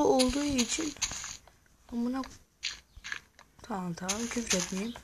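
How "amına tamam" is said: normal talking voice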